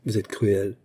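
plain reading voice

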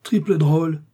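loud, high scornful jab; scornful insult. A narrator's reading. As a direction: spit the insult as he rises. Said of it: "dit Athos en se soulevant". (insulte méprisante et forte)

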